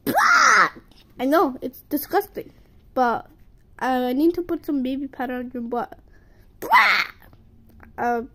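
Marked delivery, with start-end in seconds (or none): 0.0-0.7 high, loud mock retch "blah"; a hammed-up yuck noise; mock gag. Gagging at diaper. 6.6-7.0 high, loud mock retch "blah"; a hammed-up yuck noise; mock gag. Gagging at diaper.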